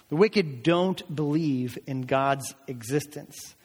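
slow down and space out "don't believe in God's existence"; measured emphasis, each word given room; slow, deliberate repeat. Real, not acted. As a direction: drive the point home slowly. Point repeated slowly for emphasis.

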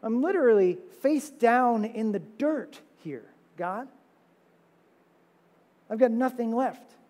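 a desperate man in the dirt; mild despairing plea; psalmist's lament. Retold or imitated. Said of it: "He said".

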